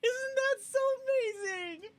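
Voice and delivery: choked up, fighting back tears; welling up as she watches; tearful. Real, not acted. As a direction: voice cracking high with real tears. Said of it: "I tear up every time I see that".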